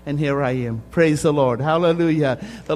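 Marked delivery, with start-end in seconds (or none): 0.9-2.4 praising out loud. Real, not acted. Preacher exults after miracle story.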